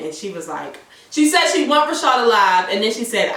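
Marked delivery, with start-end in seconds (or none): none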